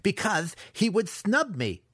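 high-pitched, aggrieved outburst; angry yell. A narrator's reading. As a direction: burst out with wounded pride. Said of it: "Massage yelled".

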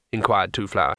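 usual speaking voice

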